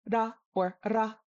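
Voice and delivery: ra tapped, then ra rolled. Shown as a demonstration. Teacher demonstrates Eastern Tibetan, ra tapped or rolled. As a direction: tap the first R, roll the second. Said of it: "they use a tapped or a rolled R".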